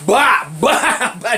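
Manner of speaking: loud, high "bah" blows; imitated punch noises. Teller showing the collar-grab beating, loud punch noises. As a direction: hammer out punch sounds with a laugh breaking in. Acting out punches while grabbed.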